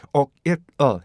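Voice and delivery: 옥, 잇, 어 as three short separated syllables; oil in three parts. Shown as a demonstration. Teacher reads oil part by part, segmented pronunciation. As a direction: chop oil into "옥, 잇, 어". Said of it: "삼 음절로 발음해야지".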